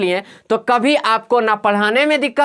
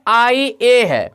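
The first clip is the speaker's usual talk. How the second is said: slow spelling; much slower than usual. Shown as a demonstration. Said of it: "देखिए आईएनआईटीआईएएल".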